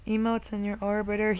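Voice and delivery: ordinary talk, nothing marked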